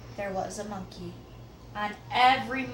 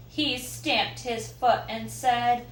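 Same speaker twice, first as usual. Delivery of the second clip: usual speaking voice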